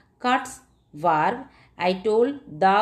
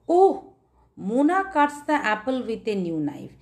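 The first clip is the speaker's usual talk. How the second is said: surprised exclamation; 2 semitones higher than usual. Shown as a demonstration. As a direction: gasp "Oh" with surprise at the girl holding the knife. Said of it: "the expression".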